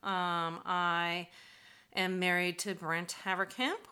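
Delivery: ordinary delivery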